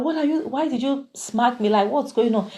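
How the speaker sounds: high, startled why did you smack me; shocked protest. Retold or imitated. AGK to Andy after the slap, high shocked protest. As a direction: squeal it in disbelief.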